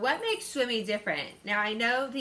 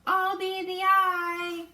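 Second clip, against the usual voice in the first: much higher, slow, loud and proud; proud declaration; 12 semitones higher, 6 dB louder, slower than usual. Acted. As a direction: claim the best spot with delight. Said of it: "he said".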